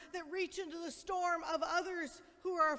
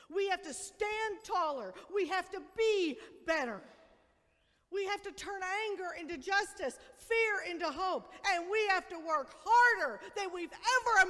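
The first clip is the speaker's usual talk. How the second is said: rousing preaching; 2 semitones higher, 4 dB louder than usual. Acted. Pastor rallying her church, rousing preaching. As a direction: build the call, louder and stronger. Preaching at full force opening.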